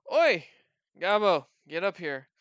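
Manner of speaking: calling out. Acted. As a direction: holler for someone to come over. Bartender calls goblin over.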